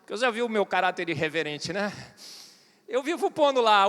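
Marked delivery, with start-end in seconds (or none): none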